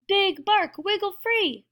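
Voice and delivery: high, urgent call upward; shouting. A narrator's reading. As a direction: shout encouragement, pitched high. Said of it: "the prairie dog shouted".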